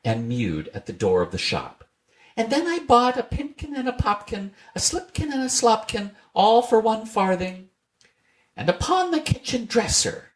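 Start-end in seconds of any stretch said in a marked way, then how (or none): 2.3-10.3 very high cheeky mouse voices; rude little mice. A narrator's reading. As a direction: tease the cat in tiny voices. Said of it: "added the rude little mice".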